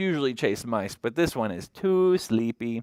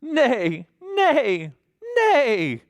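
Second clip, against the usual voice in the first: horse neigh; 10 semitones higher, 4 dB louder, much slower than usual. Acted. Horse calls in picture book.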